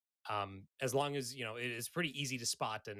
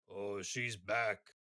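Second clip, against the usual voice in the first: slow ogre aside; 5 semitones lower, much slower than usual. Acted.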